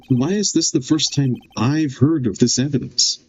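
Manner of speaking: usual speaking voice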